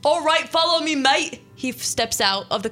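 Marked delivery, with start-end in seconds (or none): 0.0-1.4 higher mock British accent, a bit louder; same jumbled British accent, brisk and friendly; weird British accent. Vampire tells the visitor to follow him, in a mock British accent. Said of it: "he asked in a weird British accent".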